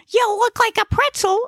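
very high comic character voice; high-pitched, blunt little character; Gnesi. Acted. Gnesi needles his cramped passenger, blunt high voice. Character speaks in narrated story.

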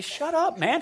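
high-pitched mock rebuff; mock dismissal. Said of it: "God doesn't say".

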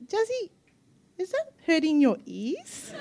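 a light hint of a husband poking fun; teasing husband. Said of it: "he said to Jazzy".

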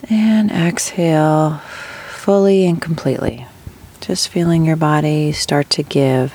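unhurried meditation guide, long pauses between phrases; guided meditation. Acted. Host guiding listeners with chronic pain into rest, guided meditation. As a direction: ease them gently into breathing, drawing out key words.

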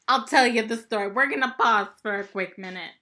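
usual speaking voice

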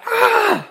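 a high, loud frustrated scream. Retold or imitated. Jean stuck in traffic, screaming high and loud. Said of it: "Jean's just like".

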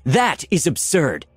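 high, slow, indignant dismissal; indignant retort. A narrator's reading. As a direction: throw it back, affronted. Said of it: "Valeria retorted indignantly".

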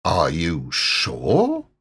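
a let-down beast checking; doubtful Heffalump. Pretend.

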